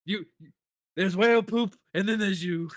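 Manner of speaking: loud, high, laughing rant; busy dismissive NPC. Acted. A busy NPC brushing off the heroes, loud and laughing. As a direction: shout it like someone with no time for you.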